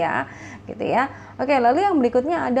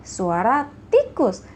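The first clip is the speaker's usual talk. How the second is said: normal talking voice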